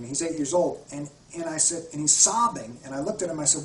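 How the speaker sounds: usual speaking voice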